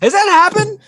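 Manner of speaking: high, loud surprised question. Real, not acted. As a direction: ask it in sudden disbelief.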